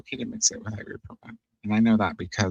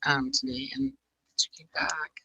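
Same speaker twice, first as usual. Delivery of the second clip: gentle whisper; 8 semitones higher than usual. Real, not acted. Whispered sign-off to viewers.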